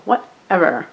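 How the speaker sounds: put-on falling Valley girl "whatever". Shown as a demonstration. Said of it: "I sound like a Valley girl".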